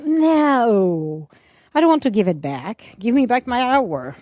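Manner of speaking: loud, high mock complaint; whiny protest. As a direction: demand the hour back, loud and high. Mock protest about lost hour.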